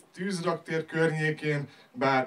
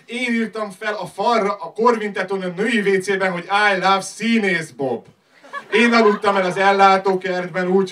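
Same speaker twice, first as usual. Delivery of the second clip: raised, higher-pitched slam delivery; loud declaiming; 5 semitones higher, 8 dB louder than usual. Acted. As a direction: build to a loud, proud list of exploits. Slam poem builds to loud boasts. (hangosan, emelt hangon szavalva)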